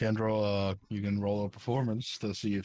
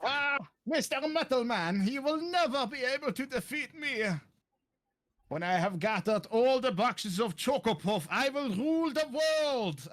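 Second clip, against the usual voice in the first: much higher, gloating villain rant; megalomaniac rant. Acted. Villain gloats about ruling world.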